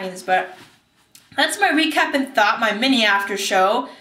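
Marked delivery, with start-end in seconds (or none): none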